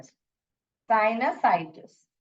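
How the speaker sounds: साइनसाइटिस said slowly as "sai-na-sai-tis"; drawn-out साइनसाइटिस, every syllable clear; final slow model of साइनसाइटिस; slow model pronunciation. Shown as a demonstration. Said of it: "ऐसे pronounce करते हैं".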